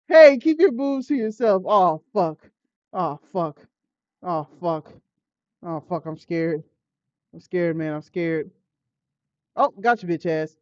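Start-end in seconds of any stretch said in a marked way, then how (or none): none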